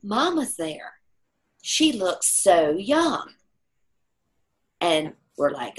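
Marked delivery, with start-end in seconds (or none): 0.0-3.3 put-on slow, wondering old man's voice; father seeing his mother. Dying father telling his child his mother is there, slow and wondering. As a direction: marvel slowly at a vision. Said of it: "And he's like".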